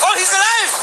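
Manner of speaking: "alive" shouted high, then falling; sudden high excited call; excited caster. Real, not acted. Shock save in esports cast.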